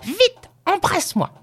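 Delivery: put-on very high, impatient old-woman cry; eager cry. A narrator's reading. Grandmother hurries grandson to kiss her, crying impatiently. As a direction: urge it eagerly at high pitch. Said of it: "cria-t-elle".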